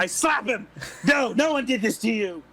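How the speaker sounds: loud, high furious yell at the victim; angry shout. Acted. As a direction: snap at him, hard and loud. Slapping and berating the man.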